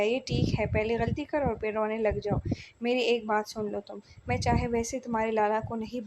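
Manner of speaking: ordinary delivery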